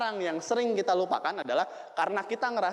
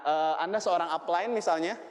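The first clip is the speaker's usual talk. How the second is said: normal talking voice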